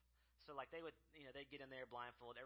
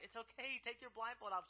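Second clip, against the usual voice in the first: loud reassurance; 11 semitones higher, 8 dB louder than usual. Retold or imitated. Staff reassure crying girl.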